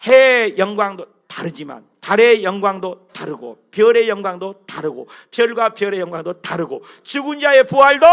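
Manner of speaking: loud, measured reading aloud; scripture reading. Acted. Preacher leading the congregation in scripture, loud and proclaiming. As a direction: proclaim the verses to the hall.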